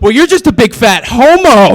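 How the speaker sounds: put-on sling it like a ten-year-old; a hammed-up bratty little sister; bratty kid insult.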